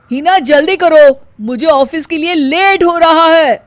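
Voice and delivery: loud, hurried call from the husband; husband late for work, calling out loud; impatient call. Acted. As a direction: hurry your wife along, loudly.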